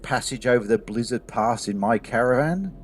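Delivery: a lightly voiced caravan master; caravan master Vizon. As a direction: size up the stranger slowly, from above. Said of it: "Vizon looks at you and he goes".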